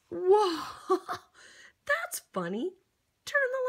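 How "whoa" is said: loud whoa breaking into a laugh; surprised whoa. Acted. Surprised by the page with the child, laughing. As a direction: caught off guard, then laugh.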